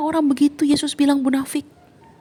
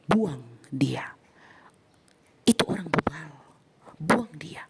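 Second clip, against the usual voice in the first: low whispered verdict; hushed; 7 semitones lower, 4 dB quieter, slower than usual. Shown as a demonstration. Preacher on church discipline, hushed and grave. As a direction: drop it quietly, grave. Casting out the unrepentant.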